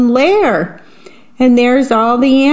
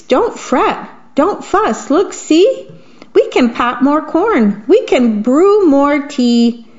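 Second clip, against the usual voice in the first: squeaky mouse; 4 semitones higher than usual. Acted. Mouse comforting the crying bear, high squeaky voice. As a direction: soothe the bear like a tiny mouse. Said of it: "Mouse squeaks".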